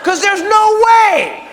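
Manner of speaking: high, drawn-out yell of disbelief; slow, loud, high protest; incredulous shout. Acted. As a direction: cry out in utter disbelief. Disbelief that famous couple are fans.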